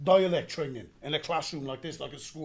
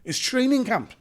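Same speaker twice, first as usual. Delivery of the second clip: insistent cover story; 6 semitones higher, 7 dB louder, slower than usual. Retold or imitated. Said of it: "They said".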